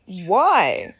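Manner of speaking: normal talking voice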